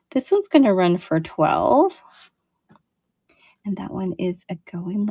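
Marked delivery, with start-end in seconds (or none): 3.6-5.1 breathy whisper, barely there; whispered aside. Real, not acted. Seller on a live show, whispering an aside while setting the piece down. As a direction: say it under your breath as you set it down.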